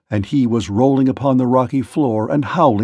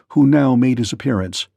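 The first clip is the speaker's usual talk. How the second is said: ordinary delivery, nothing marked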